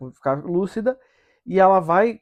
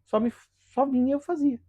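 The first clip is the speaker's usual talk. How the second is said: bewildered answer; 9 semitones higher than usual. Retold or imitated. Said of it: "Ela falou assim".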